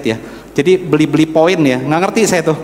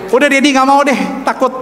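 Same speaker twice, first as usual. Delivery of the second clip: put-on higher-pitched child digging in; imitated scared refusal; 8 semitones higher than usual. Son tells Dad he won't be circumcised, mock frightened refusal. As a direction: imitate a boy backing out, voice pitched up.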